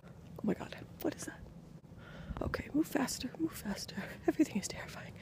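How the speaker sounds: scared hushed whisper; breathless nighttime whisper. Real, not acted. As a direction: whisper so nobody outside hears. Sneaking outside, whispering in fear.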